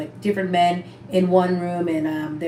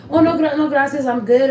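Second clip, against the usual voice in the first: higher, louder hurried no thanks; flustered refusal; 7 semitones higher, 4 dB louder than usual. Retold or imitated. Turning down the pipe from the host. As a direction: back away politely but fast. Declining the meth offer.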